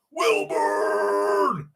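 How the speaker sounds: strained, stretched-out name call; drawn-out holler. Real, not acted. Streamer calling a viewer's name, drawn-out holler. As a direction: howl the name out long.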